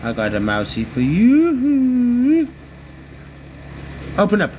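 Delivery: high, slow sing-song to a pet; sing-song coaxing. Real, not acted.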